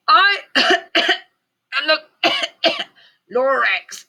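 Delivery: high, slow voice broken by coughs; coughing Lorax. A narrator's reading. Lorax coughing at the Onceler through the smog. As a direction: cough through the words like a choking creature. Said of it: "he coughed and he whiffed".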